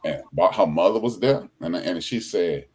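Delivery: usual speaking voice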